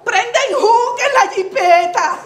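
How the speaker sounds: ordinary delivery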